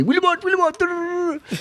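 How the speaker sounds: high mock shouting of a trainer's call; playful high impression of a shouting coach; mock shouting cornerman. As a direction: squeal out the trainer's yelling, high and mocking. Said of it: "Amir was shouting at me and screaming at me".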